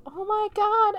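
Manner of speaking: high-pitched, mock ecstatic exclamation; imitated outcry. Imitating Lisa heard from downstairs, mock high outcry. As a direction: act out a cry overheard from another room.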